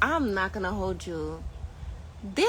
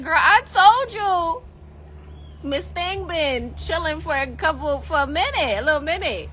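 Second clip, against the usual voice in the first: sassy reply; 5 semitones higher than usual. Retold or imitated. Her retold sassy reply.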